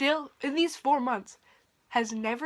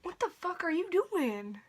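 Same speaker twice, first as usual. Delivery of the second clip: exasperated; 8 semitones higher than usual. Real, not acted. Calling out to misbehaving cat.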